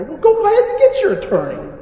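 high, loud dare; heated retort. Retold or imitated. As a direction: fire back at the threat, played up. Acting his heated retort.

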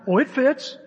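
high, loud lift at the end; acted surprise. Friend trying his own lug wrench, surprised. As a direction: discover it with a rising exclamation.

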